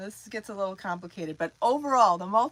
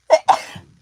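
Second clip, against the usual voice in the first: real sudden loud sneeze; one sharp sneeze; sneezing; 17 dB louder than usual. Real, not acted. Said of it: "Uh-oh, I'm gonna sneeze".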